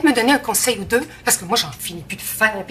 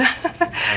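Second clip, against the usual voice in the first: nervous laugh; 7 semitones higher than usual. Real, not acted.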